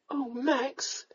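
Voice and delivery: soft, high, pitiful plea; a small high plaintive voice. A narrator's reading. Young lady pleads with the scolding lord, pitifully. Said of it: "the pitiful sound".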